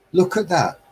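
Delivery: sharp, high look at that; outraged exclamation. Pretend. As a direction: cry it out in dismay. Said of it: "looking down thinking".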